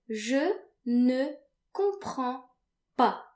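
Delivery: teacher demo, clearly paced; slow, over-articulated model. Shown as a demonstration. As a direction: slowly spell out "Je ne comprends pas" syllable by syllable. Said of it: "you can say".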